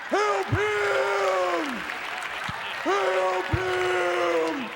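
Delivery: long high-pitched howls; drawn-out mock dog howling; dogs joining in. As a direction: howl the words like distant dogs. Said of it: "they hear it, they join in".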